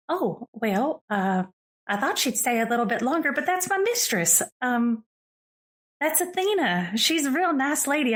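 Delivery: high-pitched, perky character voice; chirpy shop assistant. Acted. Game master voices Astrid, chirpy shopkeeper.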